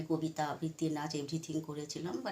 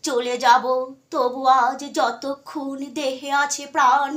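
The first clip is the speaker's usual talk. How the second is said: measured, declamatory Bengali recitation, much louder and higher than chat; poetry recitation; 5 semitones higher, 9 dB louder, slower than usual. Acted. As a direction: recite with drawn-out words and pauses, swelling on the resolute lines. Recites a Bengali poem by Sukanta. (আবেগ দিয়ে কবিতা আবৃত্তি)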